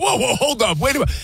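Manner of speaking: high startled double take; mock surprise. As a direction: pitch up in a startled hold on. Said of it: "You're like".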